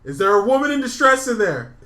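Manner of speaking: loud, high hammed-up hero shout; heroic shout. The guy shouting through the bathroom door, hammed-up heroic yell. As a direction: bellow it like a would-be rescuer. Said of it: "he's like".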